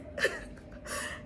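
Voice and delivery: a soft, whispered laugh of disbelief; breathy incredulous laugh. Real, not acted. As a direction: laugh under your breath at the absurdity. Reacting to his jealousy.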